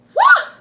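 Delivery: huge high whoop of effort; straining yell. Retold or imitated. Woman pushing out the baby in the car, straining whoop. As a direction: let out a big pushing cry. Reenacting the push.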